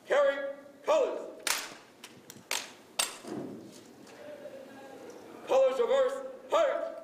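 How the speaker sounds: sharp, drawn-out military command calls; drill commands. Acted. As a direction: call each command crisply to the squad.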